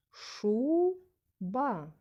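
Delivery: "Шуба" with the first syllable stretched high and loud, then a pause; stress on first syllable. Shown as a demonstration. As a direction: say "Шуба" as SHUU... ba, falling on ba. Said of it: "Який склад я говорила голосніше".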